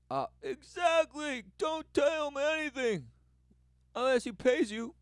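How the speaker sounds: high, loud put-on voice; mock retort. Mocking the greedy speaker, high and loud.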